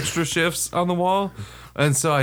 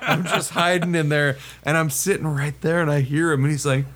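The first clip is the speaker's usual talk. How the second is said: ordinary talk, nothing marked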